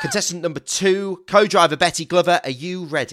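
cheesy game show host welcome; host it like a saturday night dating show; flirty teasing dating host. Acted. Introducing the second contestant.

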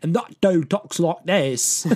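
slow, exaggerated cockney accent; super cockney. Host mimicking a British friend, super cockney accent. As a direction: imitate a thick cockney. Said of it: "like, super cockney and everything".

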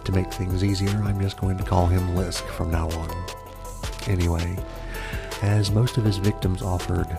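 spooky fireside storyteller; horror host. Acted. ASMR murder podcast host intro.

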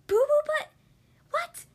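high, loud shocked squeal. Acted. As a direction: squeal in disbelief. Shock at silly name.

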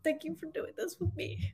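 soft, high, choked-up thanks; barely holding back tears; tearful whisper. Real, not acted. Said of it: "I'm so mad that I'm crying".

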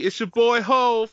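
rising, hammed-up rap-star boast. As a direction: playfully announce yourself like a hyped rapper. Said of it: "I'll be J C".

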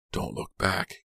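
urgent order kept under the breath; hushed order. A narrator's reading. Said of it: "Kit ordered".

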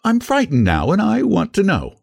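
high feminine character voice; girl's demand. A narrator's reading. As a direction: insist on knowing, high and girlish. Girl questions stranger on deck.

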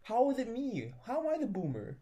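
high, incredulous protest; indignant disbelief. Real, not acted. Indignant denial of being boomer.

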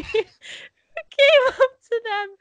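high, squeaky laughing through the words; laughing hard. Real, not acted. Host cracking up over remembered skit, laughing. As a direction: struggle to get it out through laughter.

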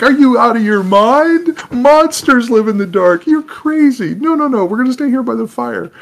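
very high, loud, incredulous squeal; hammed-up alarmed elf. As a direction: protest like a comically scared elf. Elves refuse to go out.

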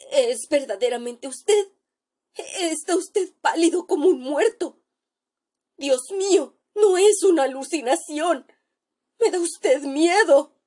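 high, shaken voice of a terrified doctor; a doctor sure he sees a ghost, voice rising in fear; frightened doctor. Acted. Terrified doctor tells his friend he looks like a corpse, high shaken voice.

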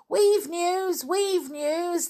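high, eager crowd call; crowd crying out. Acted. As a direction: call it out high, drawing out each word. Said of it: "they cried".

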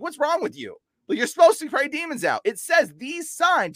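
high, incredulous scolding, slowing at the end; retold chewing out. Telling a pastor he should cast out demons, incredulous. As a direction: lecture him in disbelief, pitched high. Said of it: "chewed out a few pastors".

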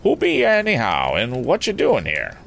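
high rustic questioning; farmer's voice. Acted. Farmer questioning the young stranger, high rustic demand. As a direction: demand answers as a country farmer. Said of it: "unmistakably the voice of a farmer".

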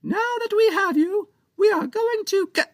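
tiny pirate captain rejecting Captain Joe's excuse; arguing tiny pirate. Acted. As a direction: spit back the word like a tiny furious pirate. Captain Big voiced.

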